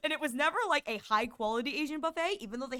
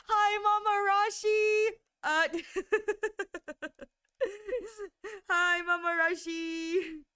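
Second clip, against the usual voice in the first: high excited greeting; 7 semitones higher, slower than usual. Real, not acted. Greeting a viewer by name.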